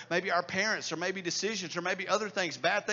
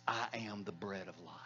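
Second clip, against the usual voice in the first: hushed, much quieter, lower quoting of Jesus' words "I am the bread of life."; soft reverent quote; 11 semitones lower, 9 dB quieter than usual. Acted. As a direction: say "I am the bread of life." low and quiet, almost to yourself. Quoting Jesus, then repeating louder.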